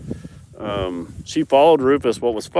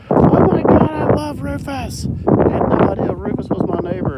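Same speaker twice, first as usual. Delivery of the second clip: much higher, louder gushing impression of her; excited neighbor; 6 dB louder than usual. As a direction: gush like a thrilled fan. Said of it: "She's like".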